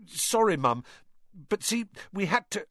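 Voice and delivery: put-on high, pleading young son; pleading boy. A narrator's reading. Caught son pleading with his furious mother, high and urgent.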